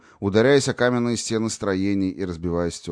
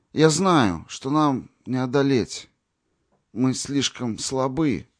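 halting, word by word; 6 semitones higher, slower than usual. A narrator's reading. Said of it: "останавливаясь на каждом слове".

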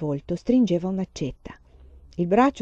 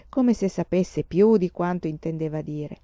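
ordinary delivery

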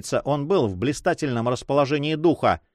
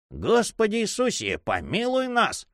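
exclaiming; 10 semitones higher than usual. A narrator's reading. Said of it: "воскликнул Дон Кристобаль".

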